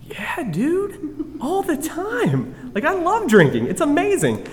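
high, excited mock reply; giddy high-voiced yes; mock eager. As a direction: pitch way up like an overeager drunk. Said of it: "And I was like".

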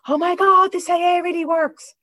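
high, loud burst of amazed delight; blurting it out, thrilled it works; excited realization. Retold or imitated. Woke up sober and amazed, high and loud. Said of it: "This is how crazy I am".

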